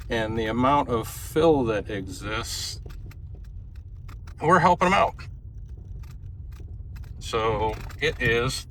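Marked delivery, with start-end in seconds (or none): 4.4-8.7 talking while bouncing in a truck; on a bumpy road. Real, not acted. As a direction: voice jolted by bumps. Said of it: "Sorry, it's pretty bumpy back here".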